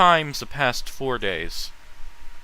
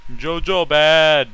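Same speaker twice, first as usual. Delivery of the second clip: slow, louder grunted two-word put-down; blunt caveman verdict; 4 semitones higher, 7 dB louder, slower than usual. Acted. Streamer dismissing JoJo to his friend, slow grunted verdict. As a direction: drop it like a caveman's final word.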